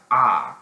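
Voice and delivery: ah as a loud, open back vowel; British A. Shown as a demonstration. Explaining the British A to viewers, loud open ah. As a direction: sound out a broad British AH. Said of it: "the A in British English".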